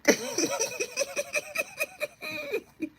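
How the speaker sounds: long, high-pitched cackle; high laughing squeal. Real, not acted. Laughs at her own bit.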